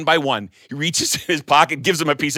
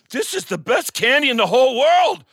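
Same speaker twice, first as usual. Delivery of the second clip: ecstatic; 9 semitones higher than usual. Acted. Teacher ecstatic over candy.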